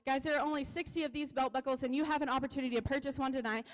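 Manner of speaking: normal talking voice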